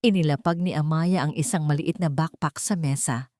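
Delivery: usual speaking voice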